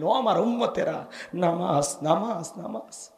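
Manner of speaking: soft appeal fading to a whisper; hushed call dying away; dying Prophet's last words. Acted. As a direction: repeat it ever fainter, as with a last breath. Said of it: "আপনাকে আমাকে বলে গেলেন".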